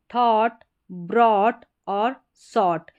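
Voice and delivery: slow, carefully voiced थॉट ब्रॉट सॉट; word demo. Shown as a demonstration. Teacher models the aw words slowly. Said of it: "इसी से मिलता जुलता है ये sound".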